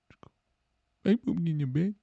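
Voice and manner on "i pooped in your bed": high, small mock-girlfriend voice; sad girlfriend. Girlfriend realizes what she did, small high voice. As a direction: squeak it in embarrassed disbelief. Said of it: "She's a little crying".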